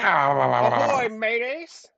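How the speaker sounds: pirate ahoy, loud and rolling; pirate accent. Pretend. Host greets the Friday Fallout audience in a pirate accent. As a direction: hail the crew like a pirate captain. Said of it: "I don't know why I chose this accent".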